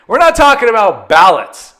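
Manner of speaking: indignant son cutting dad off; retold indignant refusal. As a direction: put your foot down, exasperated. Retold refusal to discuss ballots.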